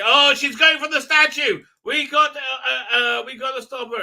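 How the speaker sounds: high, loud East London panic; hammed-up East London alarm; mock East London. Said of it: "They both sound like they're from, like, East".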